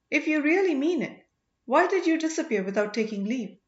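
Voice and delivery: lightly reproachful, half hurt; mildly angry princess demanding why he left; note of anger. A narrator's reading. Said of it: "with a note of anger in her voice".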